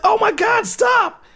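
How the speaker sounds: high, loud pleading scream to stop; terrified shout. Retold or imitated. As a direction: yell for your life at the attacker. Being stabbed in the dream.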